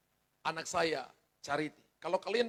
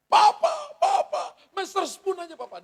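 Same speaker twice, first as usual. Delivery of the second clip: put-on bargaining child; 11 semitones higher, 12 dB louder than usual. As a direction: whine like a kid haggling for a lighter cane. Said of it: "Dia sudah mulai tawar rotan". (anak menawar rotan ke papa dengan suara tinggi merengek)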